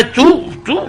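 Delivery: high squeaky mock yelp; a sudden comic yelp, pitched high; squeal of pain. Wife punches the mirror, high comic yelp.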